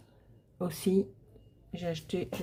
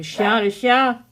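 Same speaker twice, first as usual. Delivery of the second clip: loud call to the dog; 5 semitones higher, 13 dB louder, much slower than usual. Real, not acted. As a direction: call out loudly across the room. Calling out to the dog.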